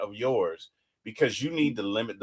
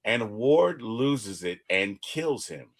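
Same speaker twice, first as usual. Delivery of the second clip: ordinary talk, nothing marked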